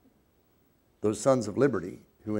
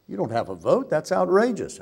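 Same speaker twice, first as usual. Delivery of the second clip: mock outraged speaker; 9 semitones higher, 7 dB louder than usual. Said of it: "I wish I could do a Boston Irish accent".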